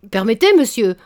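loud indignant interruption; revolting interruption. Acted. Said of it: "interrompit le jeune homme qui se révoltait".

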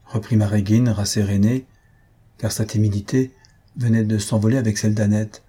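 plain reading voice

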